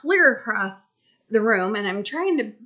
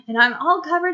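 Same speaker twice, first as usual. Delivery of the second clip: plain reading voice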